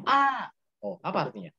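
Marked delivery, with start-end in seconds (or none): 0.0-0.5 a sharp, much higher cry of "ah"; pained ah. Shown as a demonstration. Teacher demos the cry of a man stepping on a nail. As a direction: yelp "ah" as if a nail just went in. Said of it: "dia terkena paku".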